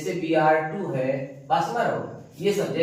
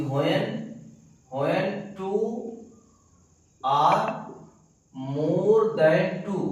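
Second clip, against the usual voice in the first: word-by-word dictation with long pauses; slow dictation; much slower than usual. Acted. Teacher dictating a definition for students to write, slow dictation. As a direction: dictate slowly, repeating each phrase for note-takers.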